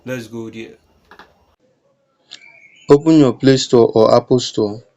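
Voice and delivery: ordinary talk, nothing marked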